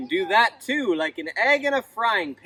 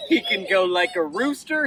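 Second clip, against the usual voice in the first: ordinary delivery, nothing marked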